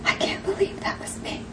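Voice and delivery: soft, breathless whisper. Retold or imitated. Carrie confessing in tears, a soft whisper. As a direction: barely breathe the words out. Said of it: "she whispers".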